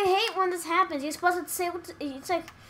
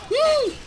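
1 high squeal; 11 semitones higher, 8 dB louder than usual. Real, not acted. Squealing at the game.

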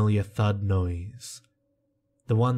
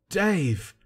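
shouted; 9 semitones higher than usual. A narrator's reading. Narrator as the friend calling down after Dave falls, high shout. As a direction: call out the name high, falling away. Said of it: "I shouted in between coughs".

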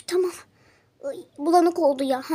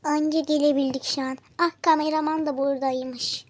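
normal talking voice

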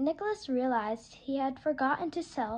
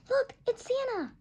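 much higher, odd character voice; turtle voice; 10 semitones higher than usual. Acted. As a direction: point him out, delighted.

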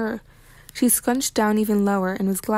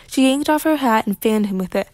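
usual speaking voice

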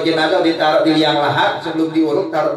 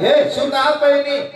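mock shout; 8 semitones higher than usual. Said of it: "Orang-orang teriak".

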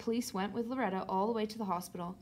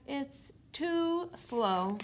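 very slow, higher, each word stretched; drawn-out punchline; 5 semitones higher, much slower than usual. A narrator's reading. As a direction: draw out the complaint for comic payoff.

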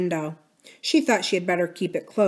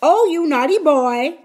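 high, loud mock scolding; reproving parrot; 8 semitones higher, 8 dB louder than usual. A narrator's reading. Parrot telling off Uncle Jocelyn, reproving. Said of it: "in a reproving tone".

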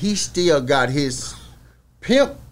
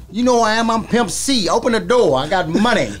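imitating [name]; 8 semitones higher, 3 dB louder than usual. [name] demands mall door open.

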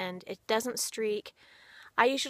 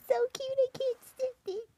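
put-on baby talk to pets. Owner greeting her rabbits in a high cooing voice. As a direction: coo up high at the bunnies.

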